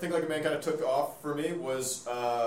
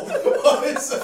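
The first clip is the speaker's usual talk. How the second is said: laughing; 12 semitones higher, 8 dB louder, much slower than usual. Real, not acted. Laughing at a friend's scowl.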